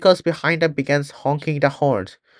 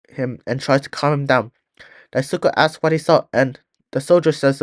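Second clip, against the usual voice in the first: plain reading voice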